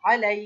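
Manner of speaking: loud pharyngeal "ain" opening আলাই; throaty ain. Shown as a demonstration. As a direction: thicken the throat on the first আ of আলাই. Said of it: "আইনটাকে একটু গলা মোটা করতে হবে".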